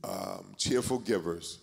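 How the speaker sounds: hushed, unhurried closing prayer. Real, not acted. Pastor blessing the offering for the congregation, hushed prayer. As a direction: pray softly over the gifts.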